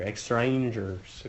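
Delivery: "Extrangers" stretched out slowly, each part clear; slow made-up word. Shown as a demonstration. Teacher links extranjeros to stranger for students, slow and drawn out. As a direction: drag out "Extrangers" so they hear stranger in it. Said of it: "Think of the word stranger".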